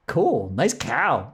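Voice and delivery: drawn-out, slow easygoing approval; slow dopey cool. Retold or imitated. Grant greeting the llama, slow and easygoing. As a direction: stretch it out, clueless and content. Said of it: "he's like".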